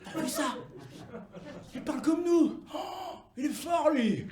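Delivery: excited admiration, stretched at the end; amazed Neapolitan crowd. Acted. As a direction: marvel aloud like an astonished local. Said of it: "Je les entends déjà, moi, ces Napolitains".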